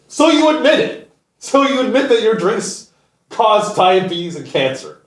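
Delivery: high, loud gotcha voice; mock accusation. Mocking PepsiCo for its confession, high gotcha voice.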